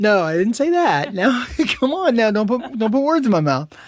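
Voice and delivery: loud, high-pitched objection through laughter; laughing protest. Real, not acted. Denying a co-host's teasing accusation, loud laughing protest. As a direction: protest loudly, amused at being misquoted. Teased, protests words put in mouth.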